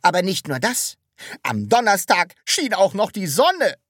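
high, loud, accusing complaint. A narrator's reading. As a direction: make it sound like a charge against the weather. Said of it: "sagte Herr Taschenbier anklagend".

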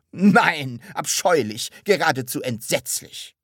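emphatic, loud revulsion, easing off; shuddering disgust. A narrator's reading. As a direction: stress each word as if shuddering. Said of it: "und schüttelte sich schaudernd". (schaudernd angewidert)

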